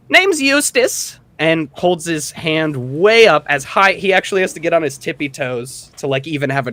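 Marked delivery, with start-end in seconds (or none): 0.1-1.2 much higher, slow, proud little gnome; a tiny gnome announcing himself grandly; gnome introduction. Acted. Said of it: "and then says".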